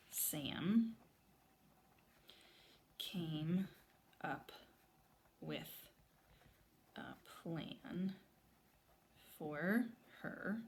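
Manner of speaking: slow, soft, each word apart; word-by-word dictation. Shown as a demonstration. Teacher writing on the chart, soft word by word dictation. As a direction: whisper each word as you write it. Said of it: "I wanna write".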